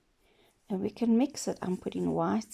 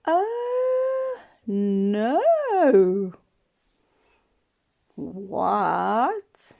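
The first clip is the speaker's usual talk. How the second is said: wail, then wonder what the sound is; hammed-up pirate alarm; pirate crying out; 5 dB louder, much slower than usual. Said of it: "He's going".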